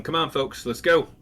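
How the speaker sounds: ordinary talk, nothing marked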